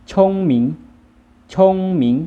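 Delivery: ชงหมิง as Chinese, very high, loud start then a drop; Chinese pronunciation. Shown as a demonstration. Teacher models the word for clever, Chinese pronunciation of ชงหมิง. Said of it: "ภาษาจีนอ่านว่า".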